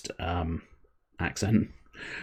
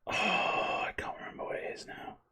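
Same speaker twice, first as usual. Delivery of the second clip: very soft, airy sigh of failed recall; sighing whisper; 11 semitones higher, 14 dB quieter than usual. Real, not acted. Streamer failing to recall a product name, sighing whisper. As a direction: breathe it out in a voiceless whisper.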